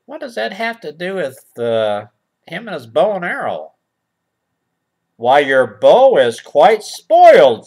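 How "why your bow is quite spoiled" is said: put-on old poet. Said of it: "said the old poet".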